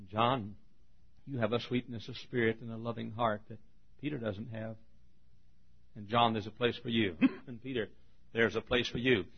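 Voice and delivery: soft, tender word to John; gentle reassurance. Acted. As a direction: drop to a quiet, soothing tone. Same speech turned gently to John.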